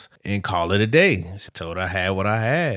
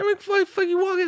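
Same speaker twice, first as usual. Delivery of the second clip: confused; faster than usual. Retold or imitated. Confused guide keeps walking.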